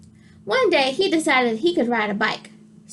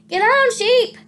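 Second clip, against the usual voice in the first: much higher, loud group yell; shouting; 10 semitones higher, 4 dB louder, slower than usual. Acted. The animals yell at Sheep to climb on, loud. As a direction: urge the sheep on. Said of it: "everyone shouted".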